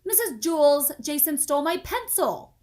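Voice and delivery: put-on girl tattling loudly; calling out. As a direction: call out to the teacher. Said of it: "called Rondi".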